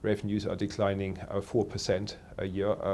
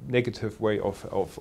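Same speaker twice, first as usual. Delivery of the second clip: ordinary talk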